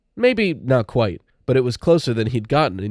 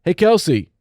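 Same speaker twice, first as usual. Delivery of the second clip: higher-pitched jock's greeting; called out; 7 semitones higher, 4 dB louder than usual. A narrator's reading. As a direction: call it out to a passing classmate. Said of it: "A couple of the jocks called out".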